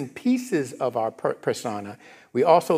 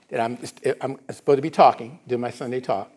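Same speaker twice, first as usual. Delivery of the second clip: usual speaking voice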